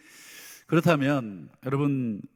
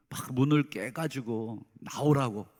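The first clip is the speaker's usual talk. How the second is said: ordinary talk, nothing marked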